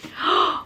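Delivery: sharp breathless gasp of joy; quick gasp at the surprise; delighted gasp. Real, not acted. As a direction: suck in air, thrilled. Opening the gift box.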